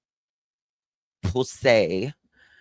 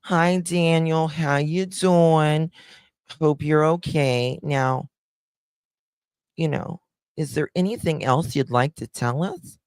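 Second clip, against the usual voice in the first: high, drawn-out fake sweetness; slow singsong politeness, pitched up; mock-friendly interviewer; 7 semitones higher than usual. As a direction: coo a greeting like an overly polite interviewer. Mock interviewer greeting Daniel.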